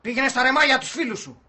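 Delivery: angry dismissal. Acted. Man sending housemate off to his friends, angry. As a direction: send him packing with a loud jab.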